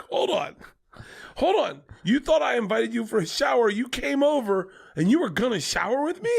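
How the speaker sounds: high, indignant mock disbelief; incredulous. Friend questioning a guest about the shower, incredulous.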